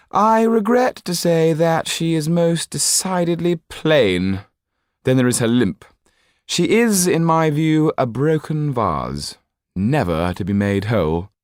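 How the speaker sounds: high, loud, disdainful aristocrat; a cold, petulant nobleman brushing off the lawyer; haughty Marquis. A narrator's reading. Marquis voiced by narrator.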